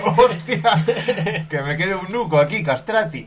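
high laughing squeal over the wave. Retold or imitated. Host retelling the cold river wave, laughing and high. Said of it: "y dice".

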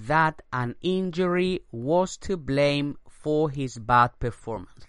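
slow English read; slow model sentence. Shown as a demonstration. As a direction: read it slowly for learners. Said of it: "Repito la frase".

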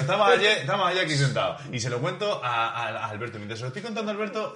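excited retelling. Real, not acted. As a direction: tell it all fired up. (contando emocionado, más agudo y fuerte)

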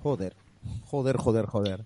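low muttered curses, under the breath; cursing under breath. Real, not acted. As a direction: swear softly to yourself in disbelief. Stunned by fully documented game.

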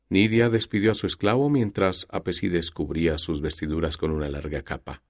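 usual speaking voice

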